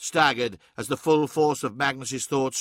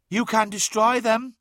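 high, eerie tempting voice; high otherworldly voice from the glass; fading reflection's voice; 9 semitones higher than usual. A narrator's reading. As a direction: voice a tempting reflection, pitched well up. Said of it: "said the fading reflections".

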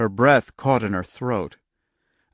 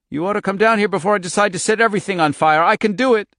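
furious threat; 9 semitones higher, 6 dB louder, faster than usual. Acted. Girl threatens to set fire.

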